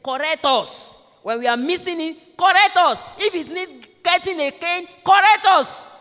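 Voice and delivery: high, loud plea hammered out at the pulpit; child's plea. Retold or imitated. Preacher voicing children to their parents, high loud plea. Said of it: "one of the children said it".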